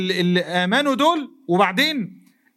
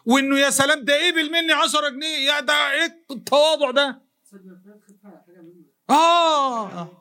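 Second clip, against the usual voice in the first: delighted giver; 5 semitones higher than usual. Acted.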